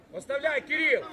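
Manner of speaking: loud sideline instruction; calling out. Real, not acted. Coach telling Кирил to leave the ball, calling out. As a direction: shout the order to the player.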